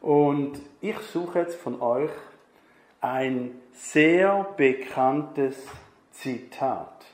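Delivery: ordinary talk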